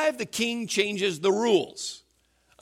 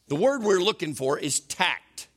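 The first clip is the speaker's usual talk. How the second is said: ordinary delivery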